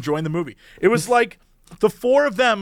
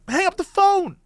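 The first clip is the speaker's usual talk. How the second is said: exasperated demand; 12 semitones higher than usual. Retold or imitated. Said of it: "I was like".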